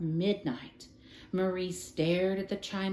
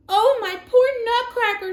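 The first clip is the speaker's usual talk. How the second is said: high, loud anguished cry; cried out; 13 semitones higher, 9 dB louder than usual. A narrator's reading. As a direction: cry out in despair. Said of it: "she cried".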